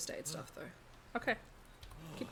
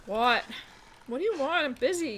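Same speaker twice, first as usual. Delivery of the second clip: annoyed shout; 5 semitones higher, 6 dB louder than usual. Pretend. Answers the caller, irritated.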